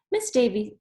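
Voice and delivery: ordinary delivery, nothing marked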